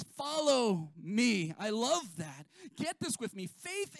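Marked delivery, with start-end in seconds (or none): 0.0-1.5 "Follow me" drawn out slowly; lightly voiced Jesus, slow and weighty; slow emphatic quote. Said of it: "No, he says".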